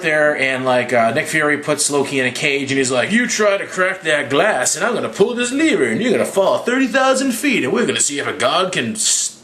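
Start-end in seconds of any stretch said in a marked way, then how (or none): 3.1-9.4 mock threat. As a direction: lay out the threat with exaggerated swoops. Voicing Nick Fury threatening Loki.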